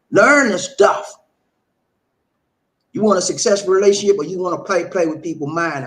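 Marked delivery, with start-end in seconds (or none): 0.1-1.1 much louder, slow, every word hammered; loud slow command. Real, not acted. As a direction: drive it home like a coach. Coach scolding listeners.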